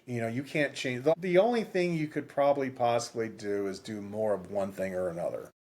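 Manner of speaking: ordinary delivery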